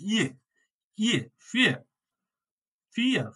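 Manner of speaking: ইয়ে as a long ee gliding open, as in ফিয়ার; ইয়ে, then ফিয়ার with long ee; German ie demonstration. Shown as a demonstration. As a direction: say ফিয়ার as "fee-a". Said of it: "এর উচ্চারণটা হবে কি?".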